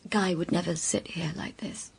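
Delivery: hushed, breathy whisper. Acted. Woman confides to Robert about her lover, intimate whisper. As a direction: say it low so only he hears.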